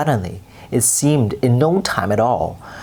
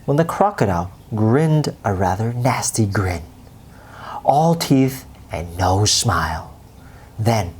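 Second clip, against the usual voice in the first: ordinary delivery, nothing marked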